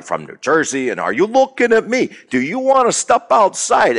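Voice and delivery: mock a pushy tough guy; loud New Jersey tough. Imitating loud New Jersey people spoiling for a fight. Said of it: "people who are really loud".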